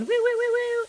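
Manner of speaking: high, loud woo woo while buffing the paper; playful woo sound. Pretend. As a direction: sing out a silly woo, woo. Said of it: "and I'm going".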